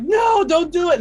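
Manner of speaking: screaming no. Acted. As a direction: shriek it at her. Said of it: "screamings of".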